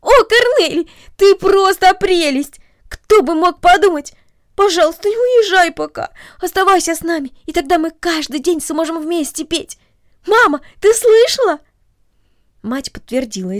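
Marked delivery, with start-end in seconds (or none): 0.0-11.6 put-on high, loud, delighted girl's voice; girl bursting with joy and pleading; excited exclamation. A narrator's reading. Girl begs her new friend to stay, high and joyful. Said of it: "воскликнула".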